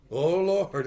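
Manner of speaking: loud, high cry for help; high, loud plea. Retold or imitated. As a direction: call out to the Lord as you fall. Falling and crying out.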